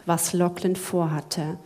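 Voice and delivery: ordinary delivery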